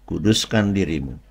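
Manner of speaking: usual speaking voice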